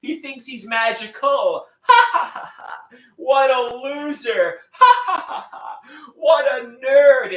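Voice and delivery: high mocking bully laugh and taunts; mocking bullies. Bullies laughing at him, high mocking jeers. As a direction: jeer like a playground bully. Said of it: "They say".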